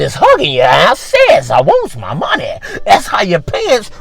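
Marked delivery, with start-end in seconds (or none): 1.5-2.6 lightly voiced menacing loan collector; mock threat, with swoops on the key words; aggressive debt collector. Underwear demanding money from its wearer, mock threatening.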